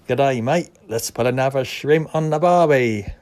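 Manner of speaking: loud put-on Australian accent; hammed-up Aussie, loud and cheery. Mock Aussie catchphrase about a film.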